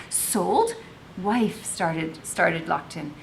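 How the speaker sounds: reading a novel aloud to the class with voiced characters; expressive read-aloud, acting out each speaker; story narration. A narrator's reading. Teacher reading Chains to students, expressive narration.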